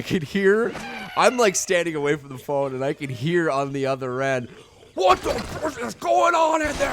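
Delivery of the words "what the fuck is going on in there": high, furious shout imitating a coach; livid coach yelling. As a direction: mimic a coach blowing up down the phone. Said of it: "He was so angry".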